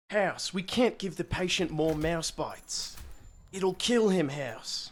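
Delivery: put-on slow Australian accent; Australian TV doctor impression; Doctor Chase. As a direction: plead in a drawn-out Australian accent. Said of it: "Doctor Chase".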